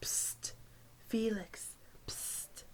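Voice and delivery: breathy whispered hiss of his name; a classmate whispers to Felix on the bus. A narrator's reading. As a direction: lean in and whisper secretly.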